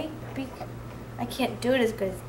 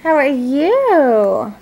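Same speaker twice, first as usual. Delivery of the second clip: put-on baby talk; 3 semitones higher, 7 dB louder, much slower than usual. Mother cooing to baby.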